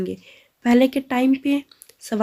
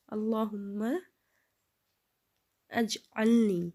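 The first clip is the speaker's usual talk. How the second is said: word by word; 8 dB quieter, much slower than usual. Shown as a demonstration. Teacher sounding out the dua word by word before joining it. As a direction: split into separate words, slow pause between them. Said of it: "تو آپ اس کو ملا کے پڑھیں گے تو اچھا رہے گا".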